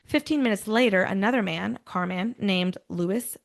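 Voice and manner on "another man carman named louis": usual speaking voice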